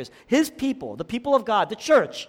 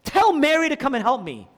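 higher, exasperated imitated demand; fed up; 6 semitones higher, 3 dB louder than usual. Preacher voicing Martha demanding her sister's help, fed up. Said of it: "Martha gets fed up".

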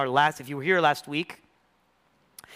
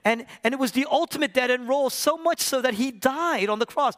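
high, loud, urgent sermon delivery; impassioned pulpit climax; full-force preaching; 12 semitones higher, 6 dB louder than usual. Real, not acted. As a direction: preach it at the top of your register.

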